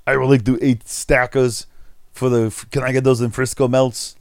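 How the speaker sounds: put-on light Austrian action-hero accent; a hint of Schwarzenegger; Arnold Schwarzenegger. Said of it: "in Arnold Schwarzenegger talk".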